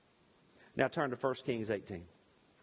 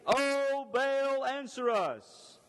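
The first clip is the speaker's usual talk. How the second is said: high, drawn-out cry of the false prophets; pleading to Baal; 11 semitones higher, 3 dB louder, much slower than usual. Acted. Prophets of Baal calling out to their god, slow and high. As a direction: call out slow and high to a god who won't answer.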